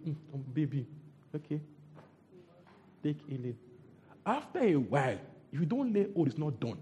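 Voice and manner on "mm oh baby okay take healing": indulgent father. Acted. God indulging a young believer.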